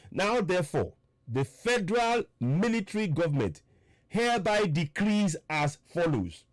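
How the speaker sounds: slow, deliberate reading of a decree. Real, not acted. Reading the military decree to listeners, slow and deliberate. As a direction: read it out slowly, phrase by phrase. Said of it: "I will read it slowly".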